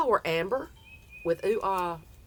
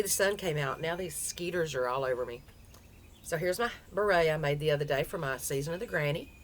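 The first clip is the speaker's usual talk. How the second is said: ordinary talk, nothing marked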